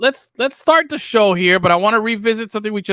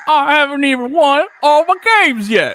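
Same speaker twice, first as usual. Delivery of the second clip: higher, laughing imitation of the coach; mock Southern accent; 9 semitones higher, 3 dB louder than usual. Host parroting coach's speech line, mock accent. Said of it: "repeats coach's line".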